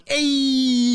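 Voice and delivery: high, bright little creature greeting; chirpy creature hey. Acted. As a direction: pop the hey up high and cheerful. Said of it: "he's just going".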